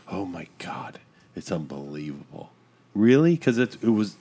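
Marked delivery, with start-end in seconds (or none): 0.1-2.5 hushed, low mock disbelief; barely voiced put-on shock; mock-scandalized whisper. Mimics the scandalized public reaction.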